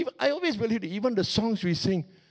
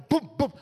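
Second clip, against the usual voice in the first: higher, punchy mock drum booms; drum boom imitation; 8 semitones higher than usual. Preacher recalling the drum at church, punchy booms. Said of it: "and then the drum".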